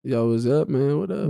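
low, casual greeting voice; different voice. Shown as a demonstration. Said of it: "I have different voices".

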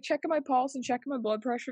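usual speaking voice

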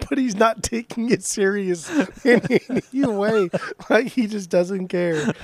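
amused, can barely hold the laugh. Real, not acted.

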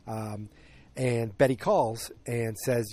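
normal talking voice

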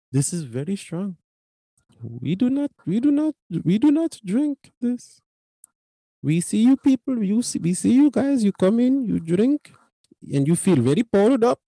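imitated Indian accent, climbing in pitch. Clerk warning customers about strong coffee, Indian-accented lecture. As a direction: put on an earnest Indian accent, rising as you explain.

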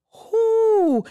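high, loud exhaled "whew"; overwhelmed whew. Real, not acted. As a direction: let out a big falling whoop of relief. Overwhelmed by God's trust.

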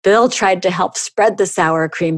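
usual speaking voice

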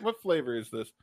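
ordinary talk, nothing marked

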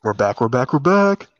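building, shouting welcome back; excited cheer. Real, not acted. Excited return to the show.

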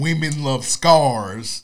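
drawn-out slow mocking quote. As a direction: stretch each word slowly. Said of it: "'Cause the quote".

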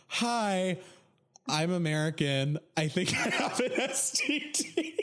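high, drawn-out embarrassed voice, laughter creeping in; awkward self-introduction. Retold or imitated. Nervous American announcing an STD to a receptionist, high and sheepish. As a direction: squeak it out slowly, trying not to laugh.

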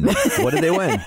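game show host voice, laughing; booming game show announcer, hammed up. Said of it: "Now I have to put on my announcer voice".

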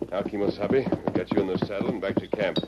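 ordinary talk, nothing marked

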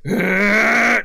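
put-on loud, high drunken war cry; acted attack yell. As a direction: holler while swinging wildly. Drunk tiefling swings wildly.

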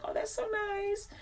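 high, soft, starry-eyed little voice; sweet naive delight. Retold or imitated. To her date, a soft high coo of delight. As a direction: coo it like a smitten teen.

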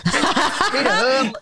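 laughing. Real, not acted. Laughing at nipple-bite story.

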